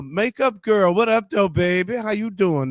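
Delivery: high, loud hype greeting; excited greeting. Real, not acted. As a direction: greet her with big energy. Greeting makeup guru excitedly.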